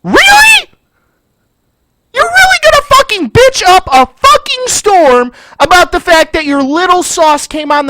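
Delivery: high, loud, incredulous yell; outraged disbelief. Real, not acted. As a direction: yell it as if you cannot believe the complaint. Outraged at the complaining customer.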